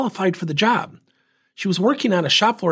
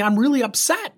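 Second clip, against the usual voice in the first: upset mother; 9 semitones higher than usual. Retold or imitated. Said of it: "They're already mad".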